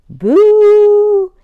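loud high shout of boo; scary boo. Acted. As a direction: jump out and shout it.

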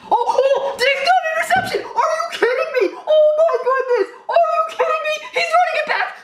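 very high, laughing, near-crying shrieks; real elated fan meltdown; ecstatic screaming. Real, not acted. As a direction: scream in total disbelief at the pick six. Pick six reaction, screaming.

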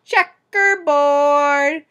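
loud, long drawn-out call; shout. Acted. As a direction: shout it out, stretching each syllable. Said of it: "Someone else shouted".

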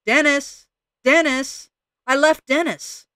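high, loud alarmed cries; panicked shout. Acted. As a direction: yell it out in sudden panic. Panicked crow remembers partner.

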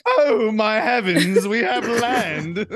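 high, slow mock posh British accent; mock posh Tory accent. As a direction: ham up a posh English colonist. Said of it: "the last thing they heard was".

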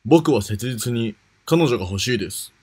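usual speaking voice

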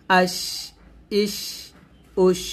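আশ, ইশ, উশ each trailing a long sh; airy shin. Shown as a demonstration. Teacher recapping the shin drill, hissing out each ending. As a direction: let the air out after আশ, ইশ, উশ. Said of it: "বাতাস বের করবেন".

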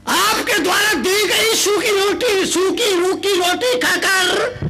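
high, loud, slow sermon plea; tearful-edged preacher; impassioned preaching. Acted. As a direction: plead with the crowd. Preacher's emotional dog parable.

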